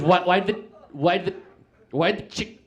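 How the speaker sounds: halting, repeated restarts on a rising voice; stuttering kid. Retold or imitated. Kid struggles to ask his joke, stuttering. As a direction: stall and restart before the word is out. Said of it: "what he's trying to ask is".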